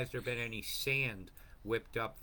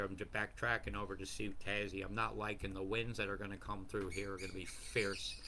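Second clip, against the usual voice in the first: usual speaking voice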